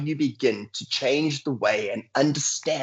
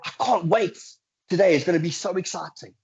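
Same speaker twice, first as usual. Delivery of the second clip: higher, quicker, eager mock excitement; gushing a touch, higher and quick; excited; 6 semitones higher than usual. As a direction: play up the excitement about the day ahead. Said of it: "What were the emotions that ran through you".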